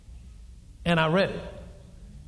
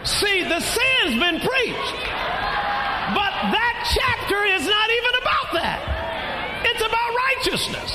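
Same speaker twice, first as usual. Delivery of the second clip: preaching shout. Acted. Preacher shouting to the congregation about righteousness. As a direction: shout it out to the church.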